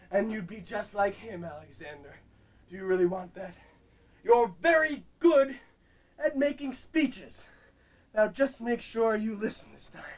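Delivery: a hint of a Shatner speech; stern Kirk. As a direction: talk the boy down calmly. Kirk lecture voice.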